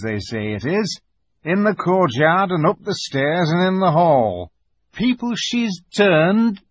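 slow, ominous build towards the horror; Mister Beaver. A narrator's reading. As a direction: draw out the dread as you warn them.

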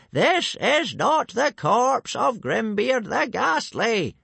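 high, loud, word-by-word shout; slow yelling. A narrator's reading. Old Wrinkly yelling to hooligans who understand only shouting, slow shout. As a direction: bellow it slowly to get through. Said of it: "he started yelling".

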